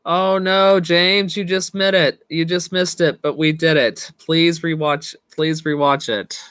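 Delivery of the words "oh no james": ordinary delivery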